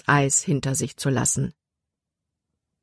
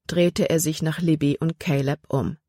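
ordinary delivery

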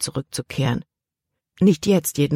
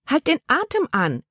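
call out; 11 semitones higher than usual. A narrator's reading. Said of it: "rief ihre Tante".

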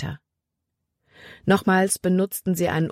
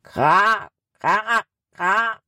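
crow's cawing reply, pitched up; answering crow; 5 semitones higher, much slower than usual. A narrator's reading. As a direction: caw back like a crow in the woods. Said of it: "antwortete eine Krähe im Wald". (antwortender Krähenruf, hoch gekrächzt)

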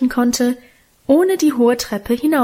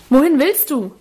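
called out after her, a bit louder; calling after; 4 semitones higher, 3 dB louder than usual. A narrator's reading. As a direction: shout after the running girl. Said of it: "rief mir Lebrecht nach". (hinterhergerufen, etwas lauter)

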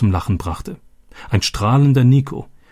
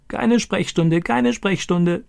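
high crowing parrot voice, the repeat slower; 11 semitones higher, slower than usual. Retold or imitated. Stani imitates Boga the parrot for Roberto, high crowing parrot. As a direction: crow it out like a parrot. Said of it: "kräht er".

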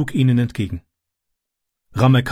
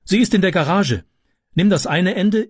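higher-pitched commanding voice; urgent orders; 6 semitones higher than usual. A narrator's reading. Mother organises the ladder carry, urgent orders. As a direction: direct a helper urgently.

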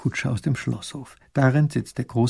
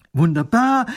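delighted; 8 semitones higher, 8 dB louder than usual. Acted. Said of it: "freut sich der Großherzog".

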